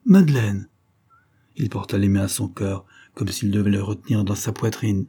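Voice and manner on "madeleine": higher, louder cry of happiness; joyful cry. A narrator's reading. Count sees Madeleine come in, joyful cry. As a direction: cry the name out with joy. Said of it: "comme un cri de bonheur".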